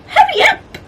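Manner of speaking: very high, loud mewing call; cat mewing in a hurry. A narrator's reading. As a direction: mew it urgently like a cat. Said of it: "mewed Prue".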